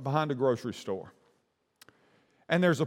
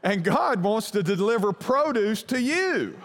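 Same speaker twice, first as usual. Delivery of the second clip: slow, raised, emphatic preaching; 8 semitones higher, slower than usual. Real, not acted. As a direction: land the sermon point. Sermon point after the story.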